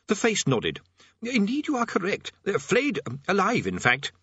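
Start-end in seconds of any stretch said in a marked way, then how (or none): 1.2-4.1 high, halting, polite voice; helpful prisoner. A narrator's reading. Prisoner confirming to Rincewind he will be flayed, politely halting. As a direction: confirm it mildly, with little hesitations.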